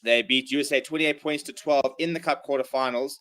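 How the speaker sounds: ordinary delivery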